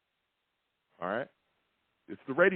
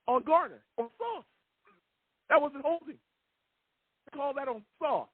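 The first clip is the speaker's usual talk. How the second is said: high, heated play-by-play of the bad calls; outraged recap; 9 semitones higher than usual. Real, not acted. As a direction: replay each call in disbelief. Raging host recounts bad calls.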